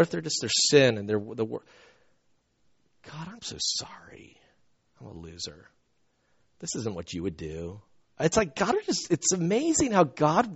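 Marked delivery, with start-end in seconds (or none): 3.0-7.8 a hushed, whispered confession to God; whispered prayer. Retold or imitated. Man confessing to God in the car, whispered. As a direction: whisper it, ashamed and sorry. Pastor retells his prayer of repentance.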